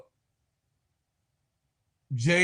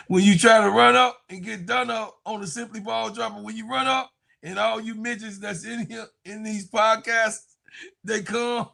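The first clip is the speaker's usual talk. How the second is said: high, laughing taunt; bragging to the podcast rivals, hyped and laughing; hyped boast; 7 semitones higher than usual. Real, not acted. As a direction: crow it over the rivals, cracking up. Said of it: "hyped boasting, laughing".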